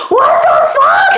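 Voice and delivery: high, drawn-out exclamation with laughter; giddy disbelief. Retold or imitated. Reliving her reaction to meeting him, giddy disbelief. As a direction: re-enact a squealed, laughing disbelief.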